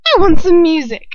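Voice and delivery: put-on high, loud childish demand; spoilt demand. A narrator's reading. Bellatrix demanding music.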